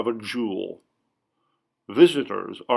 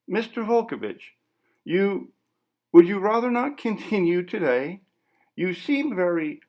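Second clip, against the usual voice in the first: lightly voiced, higher, hesitant interviewer; a cautious reporter edging around him; nervous interviewer; 8 semitones higher than usual. Interviewer hesitantly asking the artist to stop, lightly voiced.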